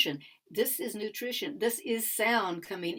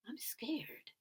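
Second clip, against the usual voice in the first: soft whispered confession, higher; whispered admission; 9 semitones higher, 7 dB quieter than usual. Retold or imitated. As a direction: breathe it out as a fearful secret. Discovering true feelings inside.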